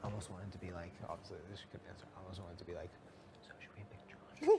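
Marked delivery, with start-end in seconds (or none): 3.4-4.6 slow conspiratorial stage whisper; mock secret whisper. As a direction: whisper it as if slipping a covert question. Imagined secret question to family.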